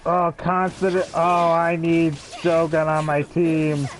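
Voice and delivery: normal talking voice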